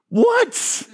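sudden high shocked what; disbelieving yelp; incredulous. Real, not acted. Preacher reacting to a false teaching, high disbelief. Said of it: "incredulous reaction".

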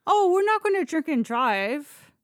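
loud, high no-no-no protest; emphatic denial. Real, not acted. To her cohost, loudly insisting they never drove drunk. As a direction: shoot that idea down fast and loud. Denying they would drink and drive.

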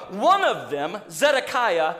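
ordinary talk

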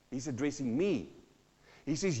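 usual speaking voice

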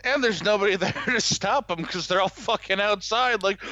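ordinary delivery

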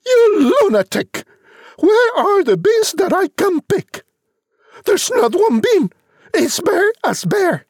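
very high, hammed-up scolding mum; furious mother. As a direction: lay into the boy at the top of your pitch. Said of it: "The mother said".

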